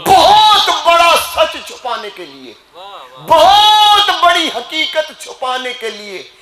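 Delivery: high attack on the first words, drawn out; impassioned, stretched-out sermon cry; accusatory preaching. Real, not acted. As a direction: hurl the accusation from the pulpit. Preacher at full force accusing clerics.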